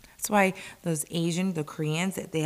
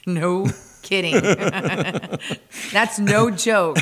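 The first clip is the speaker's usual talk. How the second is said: laughing; 5 dB louder, slower than usual. Real, not acted. Laughing at husband's testosterone joke.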